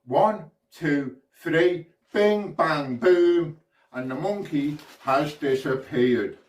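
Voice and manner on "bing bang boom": punchy incantation, loud final word; magic words. Pretend. Showman making the monkey disappear, emphatic magic words. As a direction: cast the spell, building to a booming finish. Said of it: "say the magic words".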